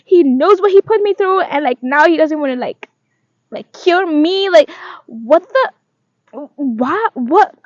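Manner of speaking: high, loud indignant rant to a friend; high outraged venting to her friend Liz; mock angry rant. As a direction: vent like you're fuming on the phone. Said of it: "I was really angry".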